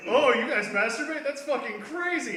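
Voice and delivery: high, drawn-out fake astonishment; mock shock. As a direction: play up scandalized disbelief, slowly. Mocks an overblown shocked reaction.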